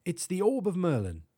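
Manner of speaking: high, proud British professor. A narrator's reading. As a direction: announce a treasure in a British accent. Professor proudly names his orb.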